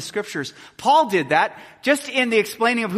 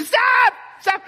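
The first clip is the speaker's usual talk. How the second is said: put-on sharp high yell to a running toddler; urgent shout; 6 dB louder than usual. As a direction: shout to stop the child in danger. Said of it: "No, they go".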